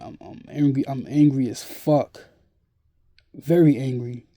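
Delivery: venting real fury at the camera; real anger. Real, not acted. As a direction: let the anger rise and burst out.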